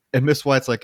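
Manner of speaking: ordinary talk, nothing marked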